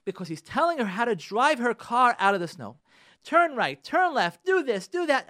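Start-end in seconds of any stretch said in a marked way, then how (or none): 3.2-5.2 higher, louder imitation of barked orders; upset husband. Upset husband telling his wife how to drive out of the snow, imitated frustration. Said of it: "husband is so upset at his wife".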